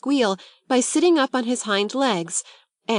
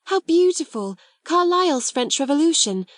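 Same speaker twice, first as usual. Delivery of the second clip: high, louder thrilled exclaiming; delighted cry; 8 semitones higher, 3 dB louder than usual. A narrator's reading. Girl finding Carlyle's French Revolution, delighted and loud. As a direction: gush over the book. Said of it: "she cried out".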